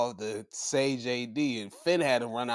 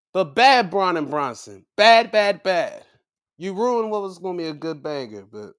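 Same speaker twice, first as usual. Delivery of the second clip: usual speaking voice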